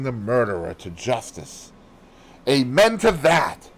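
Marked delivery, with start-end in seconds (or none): 2.5-3.7 fierce, slow and loud; a servant's fierce vow. A narrator's reading. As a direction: spit it out fiercely. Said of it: "said Dorcas fiercely".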